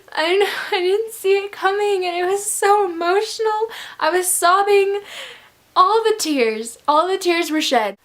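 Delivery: high-pitched, overwhelmed gush; high, distressed fan lament; emotional wailing. Real, not acted. Gushing over a sad death.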